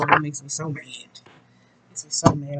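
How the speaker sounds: whispered "makes me so mad". Real, not acted. Mother venting about her teen son, whispered. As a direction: breathe it out under your breath.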